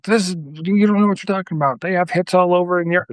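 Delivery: high, fast mock complaint from know-it-all listeners; mock indignant listeners. Podcast host mimics complaining listeners, high and fast. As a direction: needle them like a smug fan correcting you. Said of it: "people don't like that 'cause they're like".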